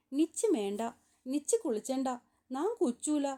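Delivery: usual speaking voice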